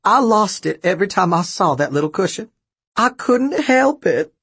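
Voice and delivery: high grandma voice, slowing at the end; amused grandma. A narrator's reading. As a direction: confess it as a helplessly tickled grandmother. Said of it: "laughing so hard tears were coming out".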